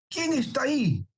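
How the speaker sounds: high stagey challenge from a Spanish Golden Age stage; theatrical Golden Age manner. Retold or imitated. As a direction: call out like a classical actor. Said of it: "Así muy de, de teatro, tú sabes, de, de oro español".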